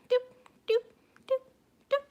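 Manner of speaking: high, playful doop for each glass; glass falling noises. Retold or imitated. Showing how his wine glasses fell, with high playful doops. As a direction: mimic glasses dropping one by one.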